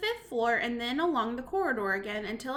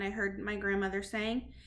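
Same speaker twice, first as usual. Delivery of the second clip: usual speaking voice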